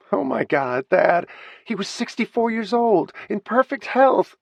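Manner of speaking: high, loud crying wail, slowing on the last words. Acted. Grieving daughter crying to Thad, high and wailing. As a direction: sob it out against his chest. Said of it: "Kate cried in Thad's arms".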